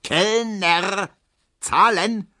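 high, drawn-out call; loud sing-out to a waiter. A narrator's reading. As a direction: call across the beer garden for the bill. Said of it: "ruft Herr Ziebland".

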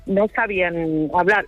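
ordinary delivery

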